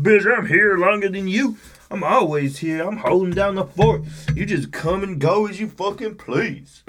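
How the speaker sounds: high-pitched indignant dog voice; Marley. Acted. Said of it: "she's like".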